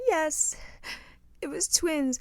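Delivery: small, high, mock-tearful voice; imitated tearful girl. Crying girl confessing to the show's host, mock tearful. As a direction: softly squeak it out, imitating upset. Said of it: "one girl just starts crying".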